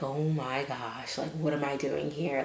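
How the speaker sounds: stunned, barely above a breath; overwhelmed hush. Retold or imitated. Arriving alone in Aruba, quiet disbelief. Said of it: "I was like".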